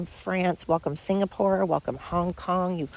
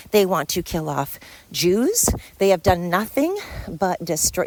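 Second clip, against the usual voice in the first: ordinary talk, nothing marked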